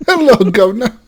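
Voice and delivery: mock Cockney greeting. Mock British greeting, laughing.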